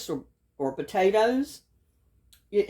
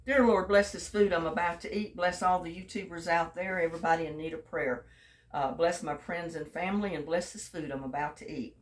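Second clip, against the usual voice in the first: a soft, quieter mealtime prayer; grace before eating; 2 semitones lower, 5 dB quieter than usual. Real, not acted. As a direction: bless the food gently, head bowed. Says grace over the burger.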